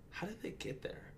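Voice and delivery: high, breathy whispered wonder. Retold or imitated. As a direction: whisper it as a puzzled aside. Wondering aloud about kids' thinking.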